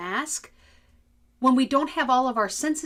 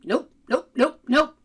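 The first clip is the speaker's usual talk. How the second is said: mock curt nopes; 4 semitones higher, 7 dB louder than usual. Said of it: "mocking the receptionist's no's".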